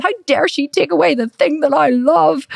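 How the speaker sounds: high, drawn-out mock outrage; indignant child. Client losing the thing she loves, childishly indignant. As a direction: wail it slowly like a wronged child. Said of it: "I felt like a child".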